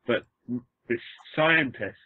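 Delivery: ordinary talk, nothing marked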